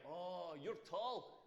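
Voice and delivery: slow, drawn-out mock-impressed girl; lightly voiced girl stating the obvious; girl in queue. Girl in the Aldi queue telling him he's tall, slow and mock-impressed. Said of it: "she's like".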